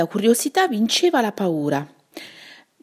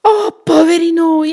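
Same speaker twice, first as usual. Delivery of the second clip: cry of alarm; 10 semitones higher, 9 dB louder than usual. Acted. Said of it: "Paolo gridò".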